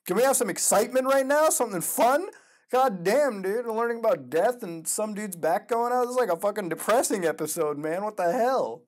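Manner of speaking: high, loud complaint; exasperated outburst. Real, not acted. Complains about a depressing episode.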